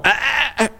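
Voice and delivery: high scolding "ah ah"; warning ah ah ah. Retold or imitated. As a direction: warn a dog off. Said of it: "I'm going".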